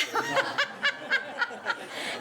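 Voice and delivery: high-pitched burst of laughter; high giggle. Real, not acted. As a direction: giggle helplessly. Laughing at the cold joke.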